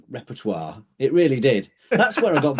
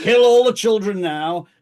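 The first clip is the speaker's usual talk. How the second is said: a hint of a hammed-up villain king, higher and louder; lightly voiced pantomime baddie; King Herod; 5 semitones higher, 4 dB louder than usual. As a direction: play a mock nativity tyrant. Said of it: "But, like, King Herod".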